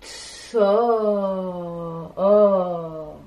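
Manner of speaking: o tenu longuement et fort; son o allongé. Shown as a demonstration. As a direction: tenez le o comme des bulles. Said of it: "nous entendons". (o prolongé et appuyé)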